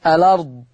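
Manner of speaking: الأرض as "alard", hamza dropped and its a moved onto the lam; الأرض with an open la and no hamza; naql. Shown as a demonstration. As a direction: say الأرض run together with no glottal stop. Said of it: "فتبقى اللام مفتوحة".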